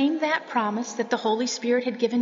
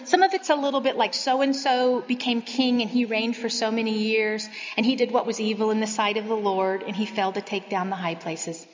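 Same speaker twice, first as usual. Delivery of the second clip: ordinary talk, nothing marked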